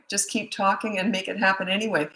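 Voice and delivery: ordinary talk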